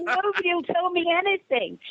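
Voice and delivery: high, put-upon complaint, lightly hammed; high mock plaintive whine. Her retold complaint.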